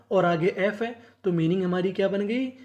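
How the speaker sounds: ordinary talk, nothing marked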